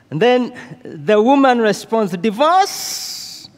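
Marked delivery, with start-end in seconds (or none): 2.2-3.5 high, rising indignant disbelief; shocked at the word, push the pitch up high. Retold or imitated. Wife reacting to the counselor's advice, indignant and incredulous. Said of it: "She said that indignantly".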